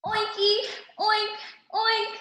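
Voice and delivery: high playful oinking; piggy oinks. A narrator's reading. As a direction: oink like a happy little pig. Pigs greeting in pig talk.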